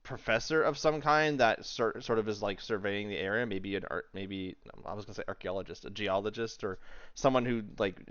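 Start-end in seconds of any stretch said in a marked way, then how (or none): none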